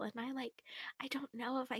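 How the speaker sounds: soft secret whisper. Real, not acted. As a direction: whisper it, barely voiced. Whispered confession of weakness.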